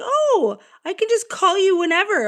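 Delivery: high, loud imitation of her; mom's quote. Mocking what his mother says on the phone, high and loud. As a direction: mimic her breezy offhand tone. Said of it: "and she's like".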